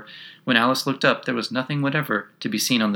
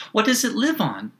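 put-on high, eager question from a curious girl; pitched high, full of wonder; great curiosity; 12 semitones higher than usual. A narrator's reading. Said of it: "Alice asked with great curiosity".

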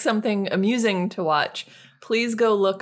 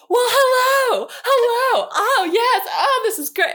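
hammed-up thirties movie star, high and loud; mock grand movie-star greeting, drawn out then gushing; Tracy Ellis Ross; 6 dB louder than usual. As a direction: gush to the cameras like an old-time film diva. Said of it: "a movie star from nineteen thirty".

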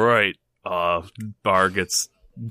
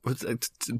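ordinary talk, nothing marked

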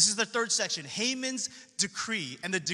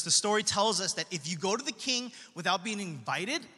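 ordinary talk

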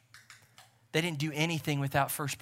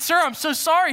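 high, loud, acted apology; frantic apology; 12 semitones higher, 9 dB louder than usual. Preacher retelling his apology to the hurt veteran, frantic and loud. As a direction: blurt it out high and loud, over-wrought.

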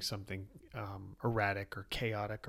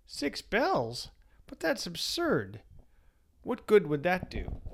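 high, disbelieving outburst; can't believe what he hears; incredulous; 7 semitones higher, 4 dB louder, slower than usual. Acted. Innkeeper objects in disbelief.

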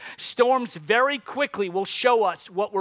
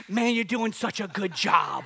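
slow, lower mock encouragement; encouraging runner; 4 semitones lower, 4 dB quieter, slower than usual. Runner looks at winded comedian, slow patronizing praise. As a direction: draw out the compliment in a low voice. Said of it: "He looks at me and says".

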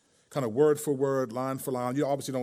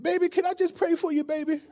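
put-on old church lady voice; 14 semitones higher than usual. Prank caller asking restaurant about church, old lady voice. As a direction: ask sweetly like an elderly churchgoer. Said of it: "the voice was like".

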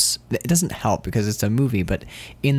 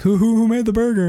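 confused cashier; 13 semitones higher, 4 dB louder, faster than usual. Retold or imitated.

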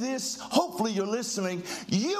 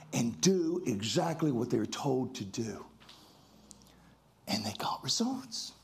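dramatic hush; 9 semitones lower, 4 dB quieter than usual. Acted. Dramatic drop to quiet.

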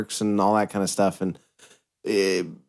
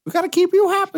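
much higher squeaky cartoon voice, playing along; 4 dB louder, faster than usual. Cohost joining the cartoon mouse bit, squeaky and high.

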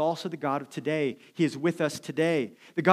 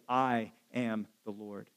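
soft slow declaration; 6 semitones lower, 8 dB quieter, slower than usual. Acted. Divine signature line.

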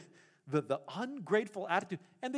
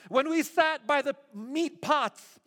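higher, louder theatrical grievance; milk the drama like a stage tragedy; grumbling crowd laying on the drama; dramatic complaint; 6 semitones higher, 4 dB louder than usual. Retold or imitated. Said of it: "They're just getting their drama on".

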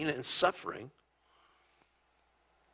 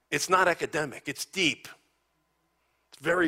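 ordinary talk

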